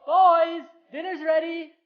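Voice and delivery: loud, high call to dinner; yelling across the room. Acted. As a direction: call them from the other room. Calling kids to dinner.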